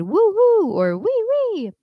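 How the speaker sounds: swooping falsetto demo squeals; high-pitched excited whoop. Shown as a demonstration. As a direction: leap up into head voice. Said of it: "with the high-pitched, excited".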